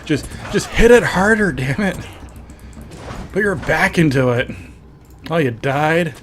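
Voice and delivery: egging someone on, in mock exasperation; Pastor. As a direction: imitate a catchphrase with forceful urging.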